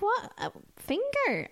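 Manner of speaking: high, incredulous squeak; mock shock. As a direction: play up baffled disbelief. Her own shocked reply.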